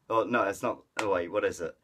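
ordinary talk

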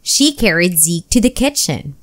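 ordinary delivery, nothing marked

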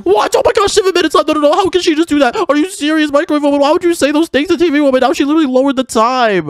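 high-pitched, frantic disbelief; shocked outrage. Acted. Player learns time was cut, shocked and outraged. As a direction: shoot up high in aghast protest.

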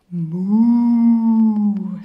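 loud, drawn-out mooing cow. Acted. Teacher mooing loudly for the children. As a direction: moo like a big cow for the class. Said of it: "says the cow".

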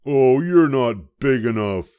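high, loud, grumpy little bug; a tiny bug spoiling for a fight, high and loud; grouchy ladybug. A narrator's reading. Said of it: "said the grouchy ladybug".

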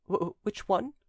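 high-pitched servant's stammer. A narrator's reading. Servant evading his master's question, stammering. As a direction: stumble on the first word. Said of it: "stammered the servant".

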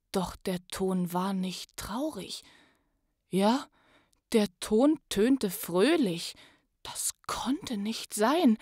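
lively rhyming read-aloud, rising to loud on the joyful parts; story narration. Acted. Podcast host reads the Grinch story to listeners, lively storytelling. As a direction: tell the Grinch tale with swelling excitement.

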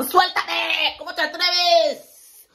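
high, loud indignation; mock outrage. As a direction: pretend to be scandalized.

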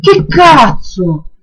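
slow, drawn-out furious swear; angry shout. Real, not acted. Outburst after surprise. (imprecazione urlata)

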